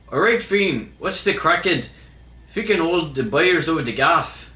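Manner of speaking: high-pitched, hammed-up Irish accent. Shown as a demonstration. Reading a viewer's misspelled message aloud, attempting an Irish accent. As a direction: read it out in your best mock Irish. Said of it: "meant to sound like an Irish accent".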